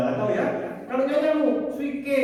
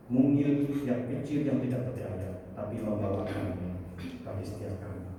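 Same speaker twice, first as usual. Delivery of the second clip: closing prayer; 4 semitones lower than usual. Acted. (doa penutup yang tenang)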